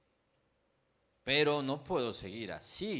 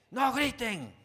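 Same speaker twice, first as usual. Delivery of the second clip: much higher, full shout, losing patience; louder exasperated shout; 11 semitones higher, 8 dB louder, slower than usual. Retold or imitated. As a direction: blow up at the kids, mock-comic. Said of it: "ese es mi tema con, con mis hijos". (grito exasperado, mucho más agudo y fuerte)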